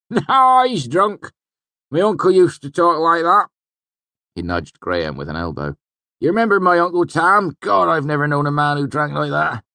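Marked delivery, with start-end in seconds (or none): 0.1-3.5 high, loud rustic character voice; village tavern regular. A narrator's reading. 6.2-9.6 high, loud rustic character voice; village tavern regular. A narrator's reading.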